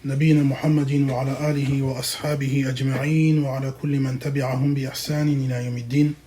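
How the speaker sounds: low, rapid, even-toned recital; formal Arabic recitation. Acted. Teacher opens a religious lesson with praise and a verse, formal recitation. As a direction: recite the opening formula low and quickly.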